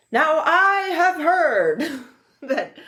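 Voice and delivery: put-on high, slow transatlantic accent breaking into a laugh; a laughing stab at FDR's transatlantic accent; old-timey transatlantic accent, cracking up; FDR impression. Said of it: "I'm trying to do even the impression".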